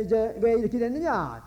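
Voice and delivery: ordinary talk, nothing marked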